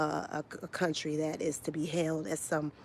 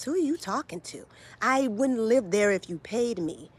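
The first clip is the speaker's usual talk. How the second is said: mocking a know-it-all viewer, sassy and high; sassy mock retort; 5 semitones higher, 3 dB louder than usual. Mocking a commenter's question.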